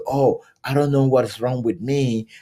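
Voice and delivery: ordinary delivery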